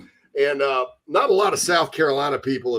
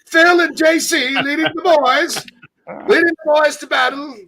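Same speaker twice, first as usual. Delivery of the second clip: wartime newsreel announcer; 14 semitones higher, 5 dB louder than usual. Acted. Host casts his co-host as war leader, mock newsreel announcer. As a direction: herald the troops in a put-on announcer voice. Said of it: "during World War Two, they had those, uh, commercials".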